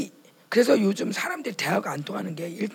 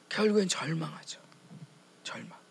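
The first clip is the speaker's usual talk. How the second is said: hushed whisper; 5 semitones lower, 7 dB quieter than usual. Real, not acted. Preacher sinks into despair.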